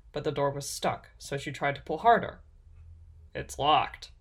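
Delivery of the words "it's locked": low, gruff half-orc voice; gruff and terse, pitched down. A narrator's reading. Half-orc to a stranger at the locked door, gruff. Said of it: "said a gruff voice behind her".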